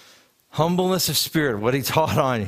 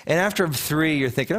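normal talking voice

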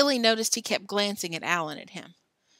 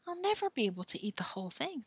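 inner thought, quick and soft; 5 semitones higher, 7 dB quieter, faster than usual. A narrator's reading. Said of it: "thought Billy".

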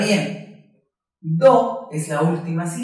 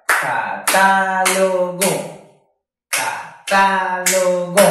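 "Catálogo" slow, chanted ca, ta, lo, go; four voice beats; 5 dB louder, much slower than usual. Shown as a demonstration. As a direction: hold each of the four syllables of "Catálogo" evenly. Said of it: "lo dividimos en cuatro golpes de voz". ("Catálogo" lento, en cuatro golpes de voz sostenidos)

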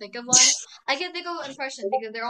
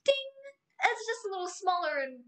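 higher, lightly voiced savage taunt; Amity Blight impression; 6 semitones higher, 11 dB louder than usual. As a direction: gloat like the smug cartoon rival. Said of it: "that was savage".

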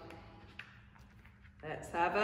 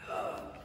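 soft gasp; 10 dB quieter than usual. Real, not acted. Quiz answer reveal.

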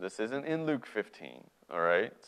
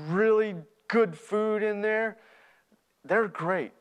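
higher, insistent, stumbling over words; earnest pleading; 7 semitones higher, 4 dB louder than usual. Acted. Younger brother explaining what he learned, earnestly insistent. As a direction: press to convince him you have changed.

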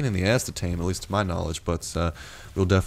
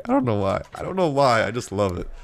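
ordinary talk

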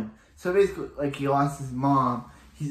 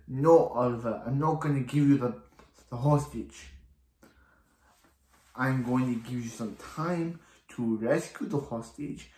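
put-on deliberate, amateurish Russian accent; terrible Russian accent. Anatoly threatens Oliver over the hostage, in a bad Russian accent. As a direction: do your best bad Russian villain accent. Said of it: "That's probably a terrible Russian accent".

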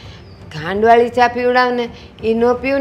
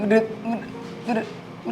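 choked sobbing stammer; 10 dB quieter, much slower than usual. Acted. Mother chokes up, crying.

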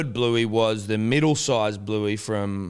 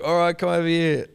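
raised, higher imitated dad call; dad calling over; 8 semitones higher, 5 dB louder than usual. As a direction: summon the child across the room.

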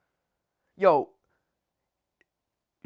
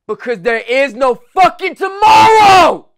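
slow, much louder shout on tomorrow; roared, drawn-out climax; motivational shout; 4 semitones higher, 10 dB louder, slower than usual. Real, not acted. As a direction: hammer the point home.

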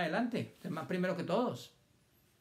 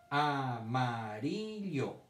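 AMARILLO drawn out very slowly, syllables linked; much slower than usual. Shown as a demonstration. Teacher sounding out amarillo for children to count, syllable by syllable. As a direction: stretch each syllable of AMARILLO. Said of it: "Dos sílabas en el centro".

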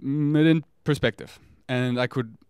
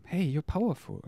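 reassuring higher self; 6 semitones higher than usual. Pretend. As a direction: soothe a small scared part gently. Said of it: "And it's like".

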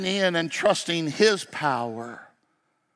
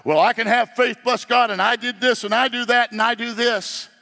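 mocking boastful believer; 6 semitones higher, 5 dB louder, faster than usual. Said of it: "and less about".

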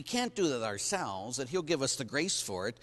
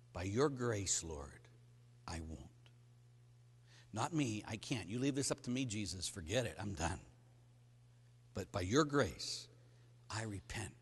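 quiet prayer; 6 semitones lower, 7 dB quieter than usual. Acted. Voiced prayer to the Lord.

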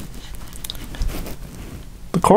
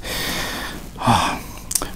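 quiet, breathed-out sigh; soft sigh; 4 semitones lower, 13 dB quieter than usual. Real, not acted. Host before addressing viewers, soft sigh. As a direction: exhale softly before moving on.

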